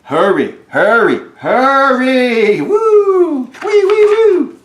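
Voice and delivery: building from loud cries to high siren wails; siren calling. Acted. Fire engine rushes out, building to siren wail. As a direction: rise into a wailing siren.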